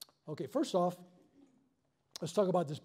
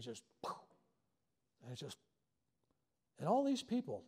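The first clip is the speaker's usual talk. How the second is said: ordinary talk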